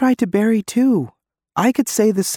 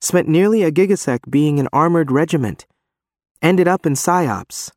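shaky voice; 7 semitones higher, 3 dB louder than usual. Acted. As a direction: voice shaking, higher. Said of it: "his voice is shaky".

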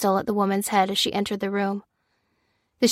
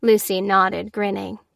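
ordinary delivery, nothing marked

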